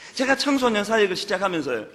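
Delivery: usual speaking voice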